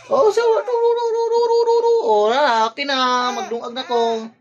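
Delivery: very high, loud cooing syllables. Real, not acted. Father coos at his baby in a very high squeaky voice.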